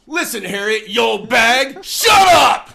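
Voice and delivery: grumpy old man snapping at his wife, hammed-up shout; mock furious yell. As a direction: explode at her, hammed up.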